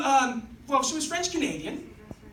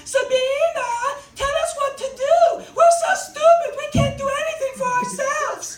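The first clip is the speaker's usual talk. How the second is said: very high, loud, mock-helpless wail; stupid-people voice; 12 semitones higher, 8 dB louder than usual. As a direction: wail like a hammed-up helpless idiot. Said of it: "her impression of what she thought stupid people sound like".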